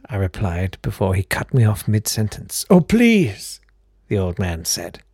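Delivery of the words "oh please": put-on very high, airy old gentleman; fluting count voice. Old count answering the door, very high fluting voice. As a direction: pipe up like a fussy old aristocrat.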